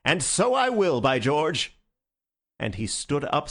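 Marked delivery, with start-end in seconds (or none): 0.0-1.8 triumphant declaration. Acted. Syme declares his resolve.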